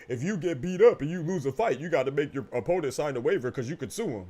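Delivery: mock the commentator lightly; mocking the idiot. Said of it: "Oh, idiot. Talking about".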